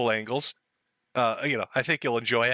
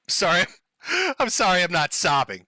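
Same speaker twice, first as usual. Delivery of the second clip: sarcastic apology; 3 semitones higher than usual. Acted.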